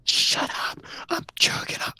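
hushed whisper. Real, not acted. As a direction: whisper so the monster won't hear. Whispers while hiding.

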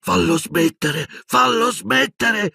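high, slow, pleading wail; an old wizard begs, drawn out; imploring. A narrator's reading. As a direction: beg him to make it stop. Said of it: "implorò Silente".

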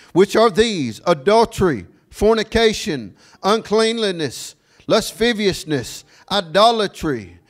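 high, punched preaching cadence; forceful scripture reading. Real, not acted. Preacher reading the list of sins to the congregation, forceful declaiming. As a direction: ring out each item separately, falling at its end.

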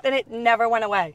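ordinary talk, nothing marked

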